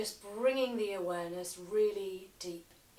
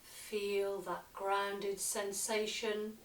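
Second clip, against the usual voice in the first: slow yoga nidra guidance with long pauses; unhurried meditation voice, letting phrase endings sink; guided meditation. Acted. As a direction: lead them gently into relaxation, phrase by phrase. Leads a relaxation practice.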